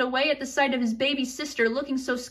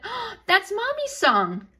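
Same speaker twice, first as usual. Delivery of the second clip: put-on higher, slow sleepy toddler voice; little girl voice; 7 semitones higher, slower than usual. Little sister recognises mom's lullaby, higher and slow. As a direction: recognise the song with wonder.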